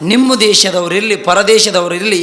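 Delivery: rising declaration. Acted. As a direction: proclaim every woman a mother. Vivekananda's firm rebuke.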